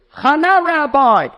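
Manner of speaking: high, loud holler; retold scream. Mother answers the rabbi from the ladies' section, mock screaming. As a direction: yell it across the room at the rabbi. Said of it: "And she screams out".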